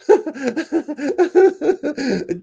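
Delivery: high loud laugh; big high laugh; burst of laughter. Real, not acted. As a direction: crack up at the joke. Laughing at own impression.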